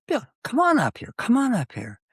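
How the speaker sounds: high, eager call inviting him up; calling out eagerly. Retold or imitated. Conductor calling Bill to the stage, eager and high. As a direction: wave him to the front with a bright call.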